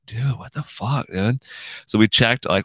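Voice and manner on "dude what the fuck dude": disbelief. Retold or imitated. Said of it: "We're like".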